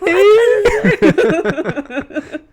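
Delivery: slow, high laughing whine. Real, not acted. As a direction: squeal it through laughter. Mocking song lyric, laughing.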